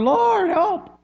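high, loud mock plea; crying out. People cry to the Lord for help, mock high cry. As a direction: wail it upward as a hammed-up cry for help. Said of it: "supposed to cry to the Lord".

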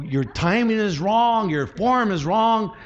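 high-pitched mock coach tearing down a player; discouraging coach. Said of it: "could you imagine a coach says like this".

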